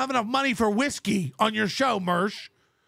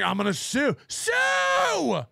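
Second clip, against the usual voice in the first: a mocking imitation of critics sputtering sue; mocking stutter; slower than usual. Mocking critics who keep threatening to sue, spluttering. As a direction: spit out sue like a sputtering blowhard. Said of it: "You all sound like stuttering".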